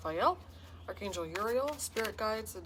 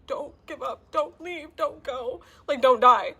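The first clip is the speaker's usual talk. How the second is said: pleading; 8 semitones higher, 11 dB louder, slower than usual. Retold or imitated. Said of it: "I'm like".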